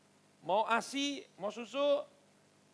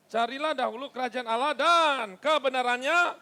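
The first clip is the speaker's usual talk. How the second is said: emphatic scripture reading; 3 semitones higher, 8 dB louder than usual. Real, not acted. Congregation reads verse aloud with preacher.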